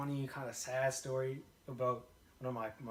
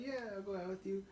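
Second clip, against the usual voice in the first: soft, higher, reluctant agreement; pity yes; 4 semitones higher, 8 dB quieter than usual. Retold or imitated. Girl giving him a pity yes, soft and higher. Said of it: "Pity, a pity yes".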